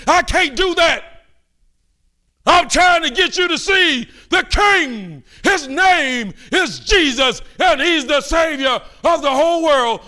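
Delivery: slow, high, loud cry; impassioned preaching. Real, not acted. Preacher calls congregation to see the King, slow and loud. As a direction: stretch each word out high.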